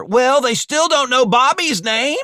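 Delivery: loud, high-pitched defensive protest; indignant squabbling robber; bickering retort. Acted. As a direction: argue back at high pitch. Jamie argues back, naming the third.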